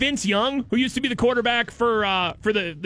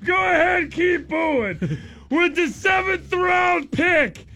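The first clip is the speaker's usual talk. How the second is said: sneering wrestling villain; 4 semitones higher, slower than usual. Retold or imitated. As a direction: heckle the crowd like a heel. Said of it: "they just parade him out there".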